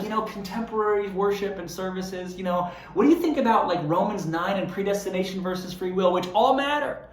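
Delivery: hammed-up insider debating doctrine; churchy insider chatter. Insiders talking over an outsider's head, mock lofty. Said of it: "be like".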